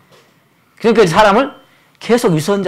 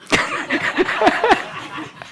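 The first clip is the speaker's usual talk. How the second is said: breathy high laugh; preacher laughing at daughter-in-law's remark; 4 dB quieter than usual. Real, not acted.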